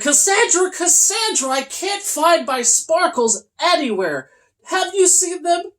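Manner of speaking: very high, loud calling; shouting. Acted. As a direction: rush up and call her urgently.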